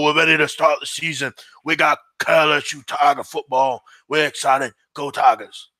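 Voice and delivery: impression of a Bayou football coach; rambling Louisiana coach talk; Ed Orgeron. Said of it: "Ed Orgeron".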